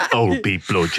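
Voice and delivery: put-on very low Austrian-accented rumble; deep mock Terminator line; Arnold Schwarzenegger impression. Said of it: "Arnold Schwarzenegger".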